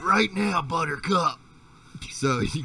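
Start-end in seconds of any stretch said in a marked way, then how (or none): 0.0-1.4 loud, high command; raised-voice summons; calling out. Real, not acted. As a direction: holler insistently for the pet. Calling the dog over loudly.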